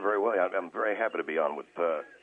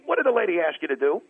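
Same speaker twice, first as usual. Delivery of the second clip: loud; 9 semitones higher, 6 dB louder than usual. Acted. Caller scolding host, mock exasperated.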